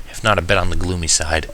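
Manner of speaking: usual speaking voice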